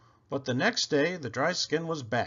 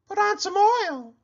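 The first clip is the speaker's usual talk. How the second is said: much higher, bright llama voice; a llama giving friendly advice; Cherry Sue voice; 6 dB louder than usual. A narrator's reading. Llama advising her friend the pig, high and sweet. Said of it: "said Cherry Sue".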